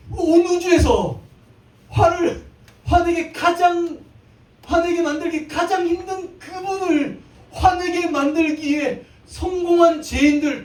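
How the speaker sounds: high, slow, shouted proclamation; fervent pulpit shout; preaching at full force. Real, not acted. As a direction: drive the point home to the congregation. Sermon climax on sinners.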